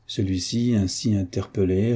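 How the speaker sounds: ordinary delivery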